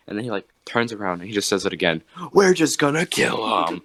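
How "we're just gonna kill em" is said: loud, high-pitched imitated declaration; emphatic punchline. Imitating Sam Hyde repeating it to a silent room, emphatic. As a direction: hurl the punchline out as the comedian did.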